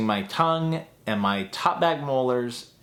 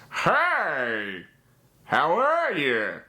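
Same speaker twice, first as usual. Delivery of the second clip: Plankton voice; 4 semitones higher, much slower than usual. Retold or imitated. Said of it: "like Plankton's voice where it's".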